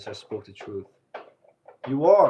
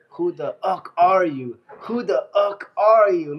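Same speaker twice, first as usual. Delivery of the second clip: slow, louder mock yell of his question; growing louder each time, slow and drawn out; retold yell; 4 semitones higher, 7 dB louder, slower than usual. Retelling what he said to me, as a slow rising yell. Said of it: "He said".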